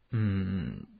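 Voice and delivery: long, low, soft "hmm"; pondering hum. A narrator's reading. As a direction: hum thoughtfully, drawn out and low. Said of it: "谢逊沉吟道". (沉吟的一声低长“嗯”)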